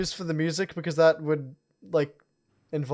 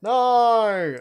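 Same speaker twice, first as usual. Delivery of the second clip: escalating no; 4 semitones higher, 7 dB louder, much slower than usual. Real, not acted. Visuals suddenly glitching on stream.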